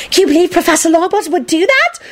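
high, gossipy schoolgirl voice; loud stage whisper. A narrator's reading. Redhead girl gossiping about professor, loudly whispered. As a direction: gossip in an audible would-be whisper. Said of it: "whispered loudly".

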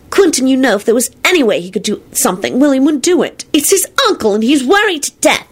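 high-pitched, emphatic reproach; stern scolding. A narrator's reading. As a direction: take Quentin to task sternly. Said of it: "Solange told them sternly".

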